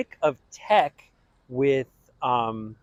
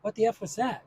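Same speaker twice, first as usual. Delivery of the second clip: baffled high-pitched What the F; mock disbelief from the director; incredulous director; 8 semitones higher than usual. Director reacting to the audition in mock disbelief. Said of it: "Bart goes".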